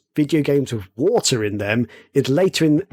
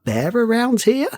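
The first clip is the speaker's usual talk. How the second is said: high, slow, teasing "be careful" warning; mock cautious warning; 8 semitones higher, slower than usual. Telling a friend at his desk there's a bear nearby, slow high teasing warning. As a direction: spook your friend with a slow, sing-song hint.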